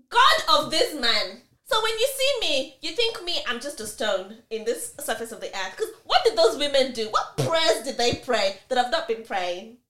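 high, loud, gushing amazement; carried away with delight; excited. Real, not acted. Telling her co-host about the husband, excitedly raving.

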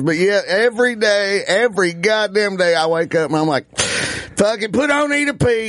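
high-pitched retold rant, rising at the end; imitated comedian. Comedian telling fans his daily music habit, imitated. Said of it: "And he goes".

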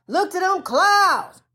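"clouds" called out slow and ringing; preaching shout. Real, not acted. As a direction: shout it out to the congregation, drawn out. Preaching, repeats line with shout.

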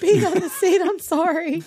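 put-on high, small guilty kid voice; sheepish kid voice. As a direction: play a kid confessing. Confessing kid voiced in bit.

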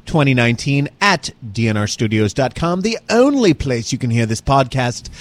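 put-on slipping into a light posh British accent while hosting; a half-put-on posh British host voice; mock posh British. Host opens his podcast in an accidental, mildly posh British accent. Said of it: "You sound very posh".

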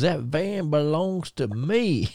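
slow, high, put-on Chattanooga Tennessee country accent; Terry Gordy's Tennessee accent. Terry Gordy guarding his van from Mercer, slow Tennessee country accent. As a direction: drag it out in a thick Tennessee country drawl. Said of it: "real country Ch-, uh, Chattanooga, Tennessee, uh, accent".

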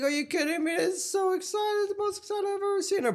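very high, excited cartoon pony voice; squeaky Pinkie Pie. Friend imitating an overjoyed cartoon pony.

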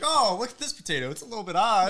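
high-pitched mock farmer; farmer finding an oddity. Acts out farmer finding odd potato.

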